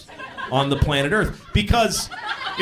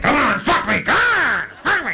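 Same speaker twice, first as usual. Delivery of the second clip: higher, louder hammed-up female demand; mock demanding woman; 8 semitones higher, 4 dB louder than usual. Comic imitating the woman demanding in bed, loud. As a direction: play the insistent lover, loud and high. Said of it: "She was like".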